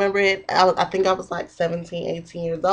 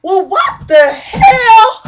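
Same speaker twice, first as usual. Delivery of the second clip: retold shout; 12 semitones higher, 8 dB louder, much slower than usual. Retold outburst at the woman.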